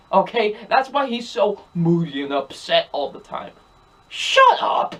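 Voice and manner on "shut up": shrill airy outburst, much higher; exasperated shout. Retold or imitated. Reviewer snapping at a whiny character, exasperated outburst. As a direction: blurt it out like you're fed up with him.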